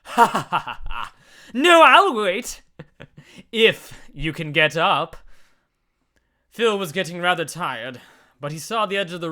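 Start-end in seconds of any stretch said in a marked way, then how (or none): 0.0-1.1 loud mocking cackle; villain cackle. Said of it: "George cackled from above". 1.5-5.2 slow, loud mocking sneer; taunting villain.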